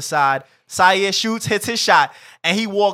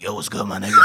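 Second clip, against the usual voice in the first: whispery croak with phlegm in the throat; croaky phlegm voice; 8 semitones lower, 8 dB quieter, much faster than usual. Retold or imitated. Sick guy greeting a friend at ball, voiceless and croaky. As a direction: croak it out, voice barely there. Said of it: "he was like".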